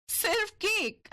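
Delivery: high scornful cackle at the cake; a jealous sister-in-law mocking the new bride; mocking laugh. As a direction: sneer then laugh it off. Jealous sister-in-law mocks Poonam.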